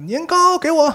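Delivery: put-on shrill high demand from a greedy old crone; bossy old woman. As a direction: bark it high like a pushy old hag. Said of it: "越来越横". (尖声蛮横地讨要的老太婆)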